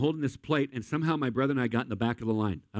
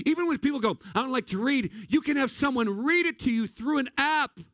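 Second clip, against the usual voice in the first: full-force preaching; 7 semitones higher than usual. Acted. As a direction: preach it, rising in urgency.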